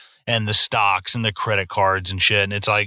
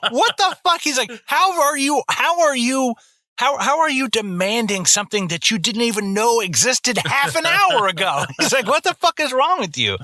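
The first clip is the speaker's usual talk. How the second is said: mock outrage, pitched way up; Louis C K ranting; 12 semitones higher than usual. Retelling a comedian's airplane rant, high incredulous yell. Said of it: "he was like".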